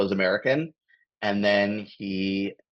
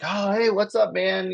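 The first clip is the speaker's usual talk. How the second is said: much higher, bright friendly hello; excited greeting; 11 semitones higher, 3 dB louder than usual. Retold or imitated. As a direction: greet him like a celebrity walked in. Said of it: "everyone's like".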